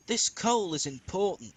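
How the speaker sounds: scolding. A narrator's reading. Fireman scolds impatient James about the coal, loud and sharp. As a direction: tell the engine off about the coal. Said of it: "his fireman scolded".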